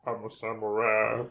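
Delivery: low, put-on samurai growl; gruff samurai. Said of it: "I wanna sound like a gruff samurai".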